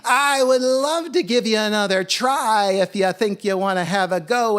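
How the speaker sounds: loud, high wake-up call; big theatrical challenge; rousing holler. A narrator's reading. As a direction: belt out the offer to sleepers. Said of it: "he banged ... woke them up and said".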